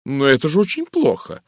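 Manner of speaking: lightly voiced indignant protest; displeased. A narrator's reading. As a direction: complain with a touch of disappointment. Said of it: "недовольно сказала миссис Гарднер".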